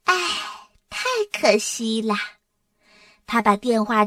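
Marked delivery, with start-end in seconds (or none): 0.0-2.2 a touch of put-on regret; sighing regret. A narrator's reading. Dora sighs over her sick brother's luck, regretful. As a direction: open on a sigh, mock pity. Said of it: "多拉叹口气说".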